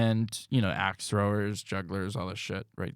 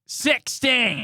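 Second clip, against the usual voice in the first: announcer voice; 13 semitones higher, 6 dB louder than usual. Acted. Joining the hammed-up intro.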